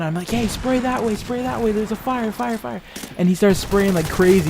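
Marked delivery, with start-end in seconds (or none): 0.3-2.8 high, held-back mock yell; urgent high-pitched mock alarm, trailing softer; imitated yelling. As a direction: pretend to yell for help without the volume. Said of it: "we were yelling".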